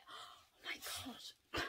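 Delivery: breathless whispered awe; hushed, amazed whisper; awed whisper. Real, not acted. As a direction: whisper it, stunned by the colour. Overwhelmed by surprise item.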